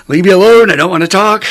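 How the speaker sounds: loud and high, a fed-up burst; a sudden loud fed-up cry to be left alone; exasperated outburst. Retold or imitated. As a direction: shove him away with the voice, half yelling. Inner plea to approaching old timer.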